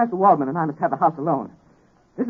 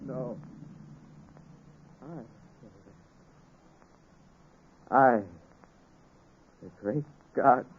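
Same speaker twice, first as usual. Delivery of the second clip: broken realization; 7 semitones lower, slower than usual. Acted. Victor over the dead housekeeper, broken whisper. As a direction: let the guilt crush the boast.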